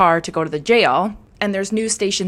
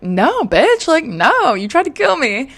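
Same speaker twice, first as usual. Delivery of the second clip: higher, louder outraged rebuff; mock outraged no, pitched up; indignant refusal; 7 semitones higher, 3 dB louder than usual. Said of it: "And he's like".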